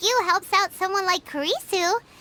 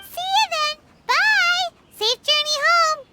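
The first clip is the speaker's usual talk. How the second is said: bright, higher call across the distance; cheerful calling out; 7 semitones higher, 3 dB louder than usual. Acted. Paimon calls goodbye to the departing merchant, cheerfully shouting. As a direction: wave them off with a cheery shout.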